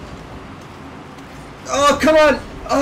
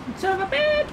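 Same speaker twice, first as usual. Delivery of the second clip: much higher, squeaky cartoon insult; 5 dB quieter, faster than usual.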